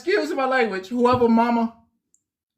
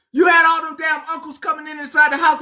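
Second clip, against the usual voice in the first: ordinary delivery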